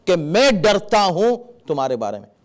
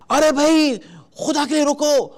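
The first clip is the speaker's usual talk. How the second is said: panicked plea; 8 semitones higher than usual. Shown as a demonstration. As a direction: beg him to stop, scared for him. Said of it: "آپ خود اتنا گھبرا گئے، اتنا ڈر گئے". (گھبرائی ہوئی اونچی التجا)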